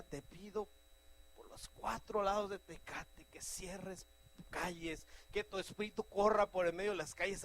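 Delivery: loud, pleading, building prayer; fervent prayer. Shown as a demonstration. Preacher modelling a fervent prayer for the city. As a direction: cry out for the city with force.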